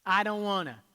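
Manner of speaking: loud, whiny I don't wanna; childish loud reluctance; whiny refusal. Pretend. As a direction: pout it out like a stubborn kid.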